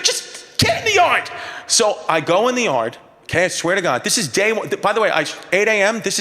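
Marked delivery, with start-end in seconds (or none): 0.0-1.3 much higher, barked order from the wife; frantic wife. Retold or imitated. Frantic wife ordering the new therapist into the yard. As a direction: order him in like a panicked wife. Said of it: "She's like".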